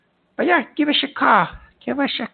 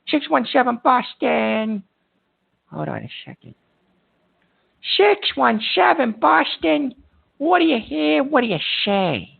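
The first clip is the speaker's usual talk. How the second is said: drawn-out call-out. Acted. Host calling a silent caller.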